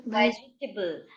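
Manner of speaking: वेजिटेबल slowly, ending in "tih-bul"; reduced table ending. Shown as a demonstration. Teacher models for students, slow वेजिटेबल with "tibble" ending. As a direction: draw out वेजिटेबल, closing on a short "tibble". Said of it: "उसको टिबल बोलोगे".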